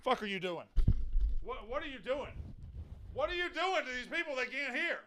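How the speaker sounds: high, soft mocking squeak; mock garbled voice. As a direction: squeak it back like a broken line. Host mocking garbled phone line.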